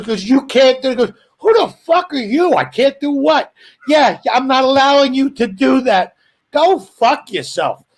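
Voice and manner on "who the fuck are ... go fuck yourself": raised, higher mock outrage; mock indignant retort. Husband snapping back at a bossy wife, mock outrage. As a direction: fire back like an offended husband.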